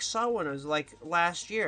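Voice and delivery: usual speaking voice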